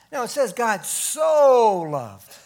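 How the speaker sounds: the word "so" drawn out and weighted; stress on so. Shown as a demonstration. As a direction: press down on "so" in "God so loved". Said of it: "why does he say "so" right there".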